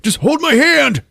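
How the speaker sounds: hammed-up frightened whimper; pleading teen. Peter pleading, voiced.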